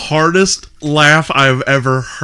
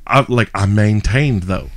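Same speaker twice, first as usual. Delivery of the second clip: ordinary talk, nothing marked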